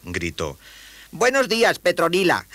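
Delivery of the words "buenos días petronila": put-on very high boyish good-morning; high-pitched youngster; cheery boy greeting. Boy greets his waking spider.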